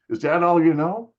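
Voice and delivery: loud, high retold complaint; exasperated uncle. Uncle in the car to a repetitive player, exasperated. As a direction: mimic the uncle who has had enough, voice climbing. Uncle fed up with repeated chords.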